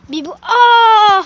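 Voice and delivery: loud, slow delighted cry; excited greeting. Real, not acted. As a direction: gush over the dog with joy. Greeting a dog joyfully.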